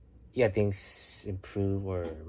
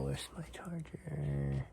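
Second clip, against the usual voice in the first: whispered, softer and higher; 7 semitones higher, 10 dB quieter, slower than usual. Real, not acted. Muttering to himself while hunting for his charger, whispered. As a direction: breathe the question without voice.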